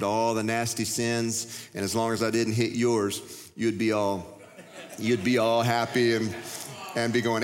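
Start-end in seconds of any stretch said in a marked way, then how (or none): none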